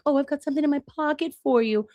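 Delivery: high, quick mock-sweet voice; teasing singsong about a gift. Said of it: "instead of me being like".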